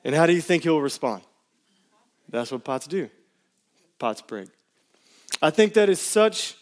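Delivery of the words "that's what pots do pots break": gentle reassurance. Retold or imitated. Repeating the friend's calm answer.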